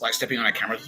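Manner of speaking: usual speaking voice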